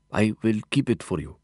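put-on accented offer with a stiff smile; reserved, accented character voice. A narrator's reading. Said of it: "Mahmoud offered, with a stiff little smile".

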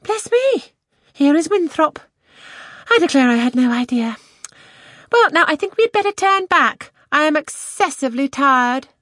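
very high, loud, fussy lady; high-pitched fretful outcry; exclaiming. A narrator's reading. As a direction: exclaim in a high put-on voice, then complain. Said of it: "Mary exclaimed".

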